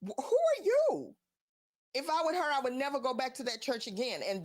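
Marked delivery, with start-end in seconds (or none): 0.1-1.1 high, loud incredulous retort; indignant. Acted. Answering the pastor's shushing, indignant. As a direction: push the voice way up in mock outrage.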